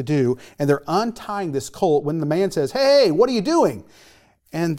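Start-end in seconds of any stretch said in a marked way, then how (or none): 2.7-3.8 challenging shout. Acted. Owner catches disciples untying colt.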